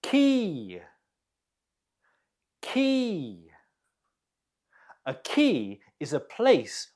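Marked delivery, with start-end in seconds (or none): 0.0-0.8 "Quay" pronounced exactly like "key"; same as key. Shown as a demonstration. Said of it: "The pronunciation is the same as". 2.6-3.4 "Quay" pronounced exactly like "key"; same as key. Shown as a demonstration. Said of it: "The pronunciation is the same as".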